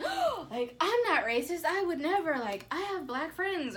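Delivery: high, loud, hammed-up offended protest; defensive mock protest. Mocking a person denying racism, high and loud. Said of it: "and they're like".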